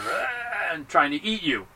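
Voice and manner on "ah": imitated monster growl; mock undead snarl; zombie lunge noise. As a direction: growl like a zombie jumping up to bite. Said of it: "they jump up and they're".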